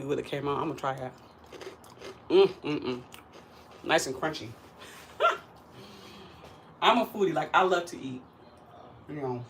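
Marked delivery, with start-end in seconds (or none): 4.5-5.4 squeaky giggle. Real, not acted. Laughing at her crunchy bite.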